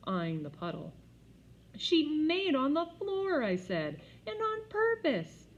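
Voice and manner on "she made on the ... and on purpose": high, loud, slow disgust; appalled tattling. A narrator's reading. Peter telling mother about the puddle, slow outraged. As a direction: drag out the outrage.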